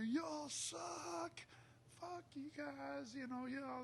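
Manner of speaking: high jeering heckler, imitated; mock heckler jeer. Teller imitating hecklers cursing the band, high mock jeer. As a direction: sneer and jeer like a drunk heckler.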